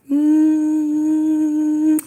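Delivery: long sustained "mmm"; drawn-out hum for a kiss; kissing sound. Pretend. Sending viewers kisses, long affectionate mmm. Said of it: "Give me some kisses".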